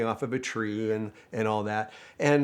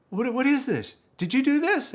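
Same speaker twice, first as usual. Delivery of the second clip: surprised; 4 dB louder than usual. Retold or imitated. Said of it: "the general manager comes to me and goes".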